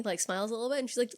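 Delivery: ordinary talk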